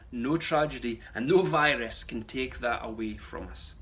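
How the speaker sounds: ordinary delivery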